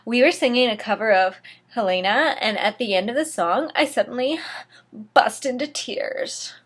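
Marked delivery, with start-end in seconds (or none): none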